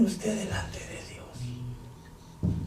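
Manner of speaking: slow solemn whisper. Acted. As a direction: lean in and whisper the question. Preacher turns intimate. (susurro lento y solemne ante la congregación)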